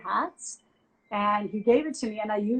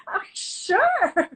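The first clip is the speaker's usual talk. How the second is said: mock eager guy; 11 semitones higher, 6 dB louder than usual. Guy on phone agreeing.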